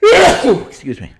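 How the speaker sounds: sudden loud cough, then excuse me; cough into mic. Real, not acted. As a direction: cough hard, apologize. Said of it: "right into the microphone".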